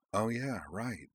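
soft dawning realisation; quietly, as it clicks; hushed understanding reply. A narrator's reading. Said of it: "she said quietly".

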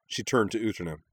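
ordinary delivery

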